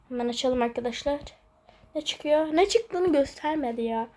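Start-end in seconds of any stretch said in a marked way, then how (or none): none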